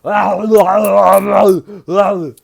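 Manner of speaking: a playful puppy chomping; puppy growl. Pretend. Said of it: "And he's like".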